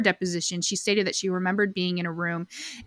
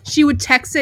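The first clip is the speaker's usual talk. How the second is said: normal talking voice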